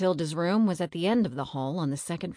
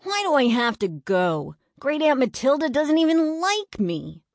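much higher, loud whiny complaint; whining; 9 semitones higher, 6 dB louder than usual. A narrator's reading. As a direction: complain like a sulky boy. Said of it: "Eddie whined".

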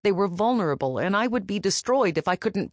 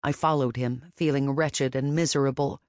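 usual speaking voice

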